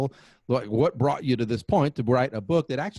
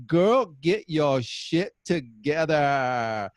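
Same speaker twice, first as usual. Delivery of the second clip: very slow, playful Texas drawl; drawn-out Texas twang; 2 semitones higher, much slower than usual. Acted. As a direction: stretch every word out in a Texas accent. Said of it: "have to say it like that".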